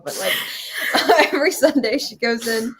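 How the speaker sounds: giggling while she says it; words spilling out through a laugh. Real, not acted. Laughing at her own slip about church, giggling.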